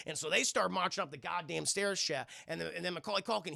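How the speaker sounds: ordinary talk, nothing marked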